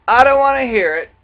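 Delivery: high, loud, fast brush-off; dismissive quote. Retold or imitated. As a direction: snap it out like a stubborn child.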